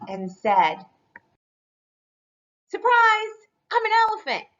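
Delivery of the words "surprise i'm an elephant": high, loud triumphant shout; spring the joke on the reader; a hammed-up shout of surprise; gleeful surprise. A narrator's reading. Duckling's twist reveal.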